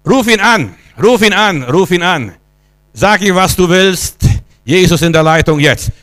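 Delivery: rousing call. Real, not acted. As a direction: urge the congregation on, pitched high. Rallying congregation to call on God.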